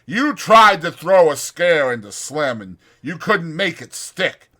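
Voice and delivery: contemptuous threat. Acted. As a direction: taunt him as a coward. Carlson threatens Curly.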